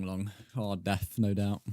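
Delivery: ordinary delivery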